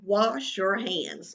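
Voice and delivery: slowly, word by word; dictating each word slowly; slow writing dictation. Shown as a demonstration. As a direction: spell it out as you write. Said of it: "And I'm going to write".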